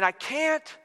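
high-pitched, pleading refusal; mock protest. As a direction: act out someone giving up. Listener protesting to God.

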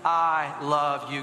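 very slow, low-pitched phrase for repeating; deliberate, low call for echo; leading a recitation. Shown as a demonstration. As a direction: drop the pitch and space out each word. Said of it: "Say that with me".

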